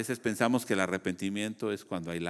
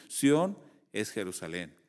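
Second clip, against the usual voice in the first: ordinary talk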